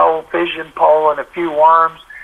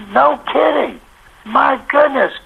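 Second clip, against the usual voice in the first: much higher, astonished phone reactions; can't believe what he's hearing; amazed; 8 semitones higher, slower than usual. Retold or imitated. Reporter hearing Singletary confirm the story, amazed and higher.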